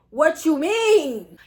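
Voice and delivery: loud, slow, drawn-out disbelief; outraged question. Retold or imitated. Student challenging the proctor's refusal, loud slow outrage. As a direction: stretch it out and raise the volume. Same reply repeated in outrage.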